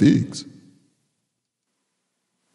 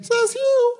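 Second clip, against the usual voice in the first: very high, fussy little bird voice. Acted. Oxpecker telling her love about the banana peel, high and fussy. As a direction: play the upset little oxpecker.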